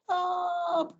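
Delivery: a very high, small, soft squeaky Stop!; tiny squeak. Acted. Tiny caterpillar's real shout at the orangutan, a high faint squeak. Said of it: "so it was more like a".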